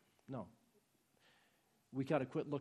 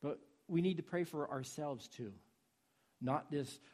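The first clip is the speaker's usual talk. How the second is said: ordinary talk, nothing marked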